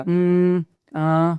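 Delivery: halting um and ah while searching for words; hesitant filler sounds. Shown as a demonstration. Showing viewers the filler sounds to avoid while talking. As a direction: fumble for the next word. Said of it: "ऐसे नहीं करना है".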